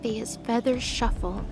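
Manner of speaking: ordinary talk, nothing marked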